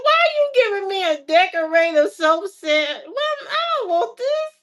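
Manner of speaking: high, loud complaint rising further; indignant disbelief. Retold or imitated. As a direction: reject the soap set. Her complaint to dad.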